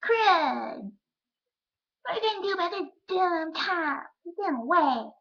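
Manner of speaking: put-on high, loud little-kid voice; put-on squeaky kid complaint; five-year-old self. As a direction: whine it loudly like an upset kindergartner. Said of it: "I said it like that more".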